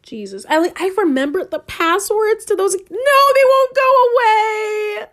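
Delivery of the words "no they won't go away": high, slow, loud distressed cry; panicked shout. Real, not acted. Vlogger whose old accounts won't delete, panicked shout. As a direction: wail it in panic.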